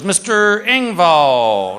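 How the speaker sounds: drawn-out name call; orderly calling out. Retold or imitated. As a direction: call the patient in, slowly stretching the name. Orderly calls out his name.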